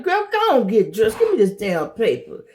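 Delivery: high, pushy mom voice barking orders; bossy parent. Retold or imitated. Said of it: "I'm like".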